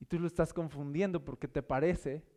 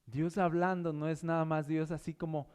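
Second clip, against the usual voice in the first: normal talking voice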